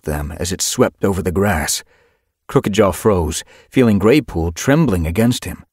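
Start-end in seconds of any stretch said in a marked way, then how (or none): none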